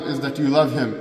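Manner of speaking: ordinary talk, nothing marked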